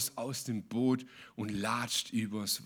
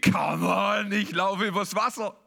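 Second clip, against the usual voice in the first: higher, louder, swaggering boast; playful mock bravado, pitched up; cocky self-quote; 7 semitones higher, 4 dB louder than usual. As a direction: crow about walking on water. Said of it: "Und ich hab gesagt".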